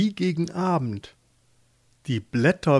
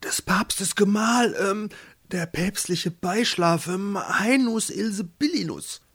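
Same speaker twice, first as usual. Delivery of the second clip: servant announcing; 6 semitones higher than usual. A narrator's reading. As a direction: announce the title carefully, groping for words. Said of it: "Die Dienerin sprach zu ihr". (Dienerin, hoch, gedehnt und stockend)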